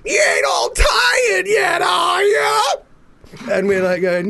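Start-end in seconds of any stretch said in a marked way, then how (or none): 0.1-2.6 very high, slow, shouted taunt. Retold or imitated. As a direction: goad the crowd, pitched way up. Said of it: "he's, like, going".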